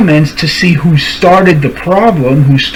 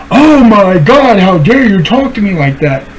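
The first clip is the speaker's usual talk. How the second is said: mock outraged woman; 3 semitones higher than usual. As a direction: ham up her indignant gasp. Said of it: "she's go".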